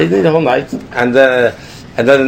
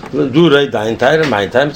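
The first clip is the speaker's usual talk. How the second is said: normal talking voice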